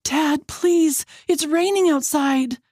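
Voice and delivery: high, desperate sister's plea; high-pitched pleading; begging. A narrator's reading. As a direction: beg your father to relent. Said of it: "Ava begs".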